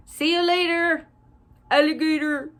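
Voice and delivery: high, playful rhyming goodbye; sing-song goodbye. Real, not acted. Sign-off rhyme to kids.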